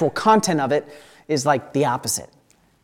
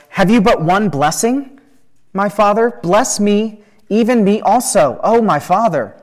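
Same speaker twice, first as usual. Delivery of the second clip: higher, louder pleading, without tears; raised-voice plea; 6 semitones higher, 5 dB louder than usual. A narrator's reading. Esau begs his father for one blessing, voice raised. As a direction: lift the voice in an urgent read-aloud plea. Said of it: "Esau lifted up his voice and wept".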